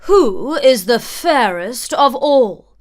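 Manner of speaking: slow, loud, proud queen; a vain queen pressing her mirror; demanding queen. A narrator's reading. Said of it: "the queen asked her mirror".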